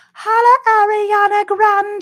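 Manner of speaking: high, loud, sustained call; hollered shout-out. Pretend. Host hails the pop star, loud singing holler. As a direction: holler it out on one high held note.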